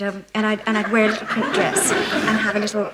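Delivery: ordinary delivery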